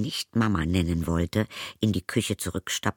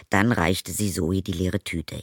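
plain reading voice

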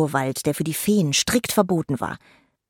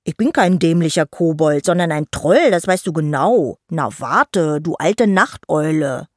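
louder mock-angry troll boy; huffy boy's comeback; offended retort; 4 dB louder than usual. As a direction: protest indignantly, then playfully threaten.

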